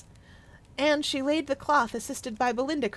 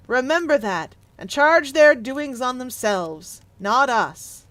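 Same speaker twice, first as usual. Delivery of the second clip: louder, grave ghostly reproach; solemn spirit; 5 dB louder than usual. Acted. The Spirit of Christmas Present answers Scrooge, loud and grave. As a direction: speak as the mighty Spirit rebuking.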